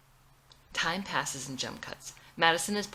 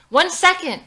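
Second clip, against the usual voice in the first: very loud, high shouts; screaming at the door and into the phone; frantic shouting; 10 semitones higher, 13 dB louder than usual. Acted. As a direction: yell at the door and phone at once. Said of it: "screams into the phone and at the door simultaneously".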